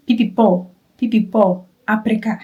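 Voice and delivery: "pipi po" and "aprika" with a hard P where F belongs; imitating the Filipino P in "pipi po, aprika". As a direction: swap every F for a P: "aprika". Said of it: "they pronounce, uh, F as P".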